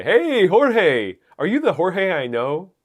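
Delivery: loud, high delighted hello; excited greeting. Real, not acted. Greeting a friend joining the stream.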